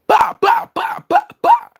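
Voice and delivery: very high sharp "bah" bursts; squeaky bah bursts. Acted. Clowning for the camera, very high bahs.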